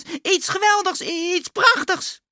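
high, thrilled, stretching the last words; excited calling. A narrator's reading. Klaas spotting something wonderful outside, high excited cry. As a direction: exclaim it high with growing wonder. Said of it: "riep Klaas".

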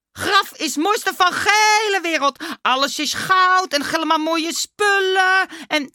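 high, loud, drawn-out marvelling; raving Dummy. A narrator's reading. Dummy raving about the golden tomb, high and drawn out. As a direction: rave slowly, stretching the words.

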